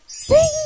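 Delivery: high, loud "ting"; sudden ringing chime made by voice; imitated magic ting. Storyteller marks the healing, high ringing ting.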